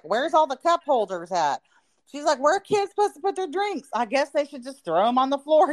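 teasing impression of a friend's Texas accent; country Texas accent. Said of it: "she's from a country town in Texas, so she talks like this".

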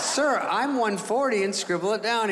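high, drawn-out retort to the doctor; mock insistence. Patient correcting the doubting doctor, mock insistent. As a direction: insist on it slowly, pitched well up.

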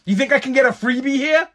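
mock husband using his wife's name for a freebie; entitled mooch. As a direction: play a smug guy angling for a freebie. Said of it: "I would never be like".

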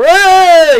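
high jungle yell on hey; Tarzan yell. Retold or imitated. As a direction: swing in with a Tarzan call.

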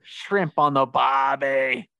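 drawn-out, hammed-up Aussie call; Australian accent. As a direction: belt it out in a mock Australian accent. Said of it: "I can only do Australian".

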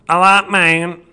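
a light take on today's Chappelle voice; current Chappelle voice. Shown as a demonstration. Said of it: "I just gave you both".

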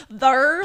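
"their" said loudly as a mocking "thur"; mocked THEIR. Imitating Sam mocking her word, loud "thur". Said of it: "he's always just like".

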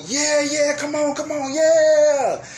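high, loud hype cheer; mock cheering. Crowd member cheering the climber, hyped high cheer.

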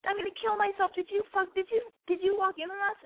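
very high, squealed re-enacted panic; hammed-up high-pitched horror; freaking out. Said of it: "So I'm freaking out".

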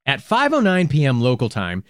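ordinary talk, nothing marked

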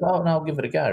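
a light impression of a child asking if he did well; imitating the boy. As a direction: play the keen young actor checking in. Boy agrees to another take.

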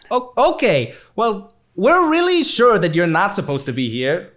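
high, lightly mocking boss voice; a boss patiently insisting; boss on the phone. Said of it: "GM voices boss on phone".

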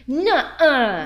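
loud, high, drawn 'no way' reaction; astonished disbelief. Acted. Hearing the archivist had the same experience, loud astonished disbelief. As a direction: act floored by the news.

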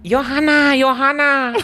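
very high, loud mock motherly calling; shrieky accented impression of a mom; co-host's mom's accent. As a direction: call out like the mom, accent hammed up. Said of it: "do, like, my mom's accent".

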